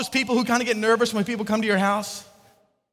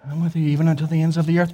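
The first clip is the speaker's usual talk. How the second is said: lightly voiced solemn scripture Jesus, quick; a hint of old-fashioned Bible pomp, rattled off fast; King James Jesus; 6 semitones lower, 3 dB louder, faster than usual. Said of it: "I got King James Jesus".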